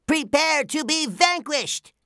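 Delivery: high-pitched heroic cartoon threat; SpongeBob's challenge. A narrator's reading. SpongeBob challenges the giant clam, high and bold. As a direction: declare victory in a high, squeaky hero voice.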